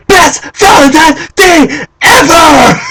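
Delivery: slow, high ecstatic shriek; flipping out. Retold or imitated. Fan looking at girlfriend on Valentine's Day, ecstatic high scream. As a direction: scream each word as if overjoyed. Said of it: "I'm flipping out".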